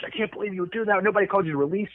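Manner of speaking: high-pitched imitation of a panicked colleague; mock freak-out. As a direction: imitate someone losing it over the radio. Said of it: "he's freaking the fuck out".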